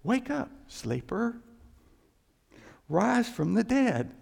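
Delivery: slow scripture reading. Shown as a demonstration. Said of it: "This is to the church".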